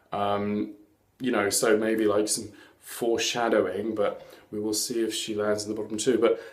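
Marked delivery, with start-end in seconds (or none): none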